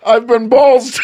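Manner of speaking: put-on high, loud Kiwi accent, cracking up; Kiwi accent attempt. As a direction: try New Zealand through rising laughter. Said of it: "It has to be a Kiwi accent".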